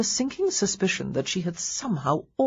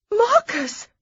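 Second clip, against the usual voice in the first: startled shriek, much higher and louder; near shriek; 5 dB louder than usual. Acted. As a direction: shriek his name in shock. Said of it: "she nearly shrieked".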